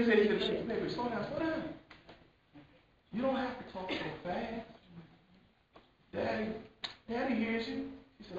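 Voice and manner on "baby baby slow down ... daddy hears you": soft, higher, tender voice; gentle reassurance. Acted. Father calming his hurried daughter, soft and tender. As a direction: soothe an overexcited child.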